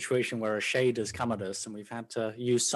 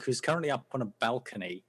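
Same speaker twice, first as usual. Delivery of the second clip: ordinary delivery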